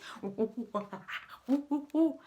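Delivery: brief hooting monkey calls; monkey noises. Pretend. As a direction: hoot like a playful monkey. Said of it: "your monkey noises".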